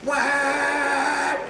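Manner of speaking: long high imitated honk; held car horn. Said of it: "held her horn down".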